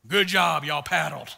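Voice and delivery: lightly voiced guide, loud at the start; gruff guide's praise. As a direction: imitate the raft guide congratulating his crew. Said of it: "he'd be like".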